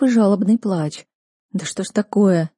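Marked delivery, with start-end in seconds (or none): none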